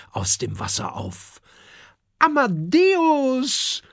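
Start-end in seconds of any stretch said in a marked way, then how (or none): 2.2-3.8 high, slow, cross shout of a name; annoyed call. A narrator's reading. Kokosnuss angrily calls up to the sea dragon Amadeus, high and cross. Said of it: "ruft Kokosnuss ärgerlich".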